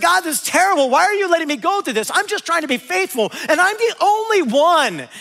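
high, loud complaint to God; acted-out wail of self-pity, building on the last words; crying out. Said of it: "he's crying out".

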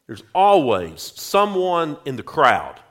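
ordinary delivery

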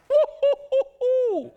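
sudden high, loud laugh; high burst of laughter. Real, not acted. Laughs at children of devil.

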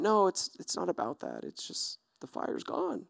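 fading to a near-silent, mopey murmur; mock forlorn. Sad camper telling a neighbour his fire is gone, mock forlorn. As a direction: play the pitiful camper who lost his fire.

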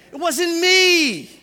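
put-on high, loud, slow childish plea; a kid's drawn-out protest to his father; pleading denial. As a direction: whine it like a guilty kid swearing innocence. Child pleading innocence to dad.

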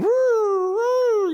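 preacher imitating an old radio dial whining between stations; radio whistle imitation.

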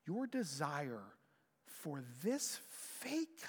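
ordinary talk

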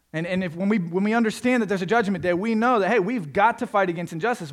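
rising preacher. Acted. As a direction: preach it with mounting fire.

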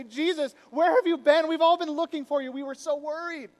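loud, high fretful scolding; exasperated. Acted. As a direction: scold him out of worry. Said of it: "in exasperation, they say".

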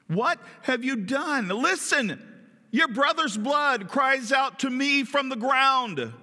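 high, drawn-out anguished accusation; grave reproach. Acted. Preacher as the Lord confronting Cain, grave reproach. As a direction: confront with slow, rising intensity.

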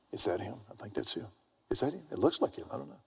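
hushed buzz of onlookers; mock crowd murmur. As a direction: play people softly checking with each other. Voicing an airport crowd's murmurs.

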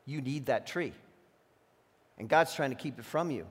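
quiet, low, coaxing tempter; a lightly voiced sly serpent, soft and low. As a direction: whisper the craving into his ear. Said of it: "Trying to put in their minds unmet needs".

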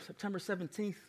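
ordinary talk, nothing marked

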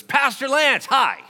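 put-on high, loud little boy's shout; loud kid greeting. Little boy in line calls out loudly to his pastor. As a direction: yell a greeting across the store like an excited kid. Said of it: "you're as loud as I am".

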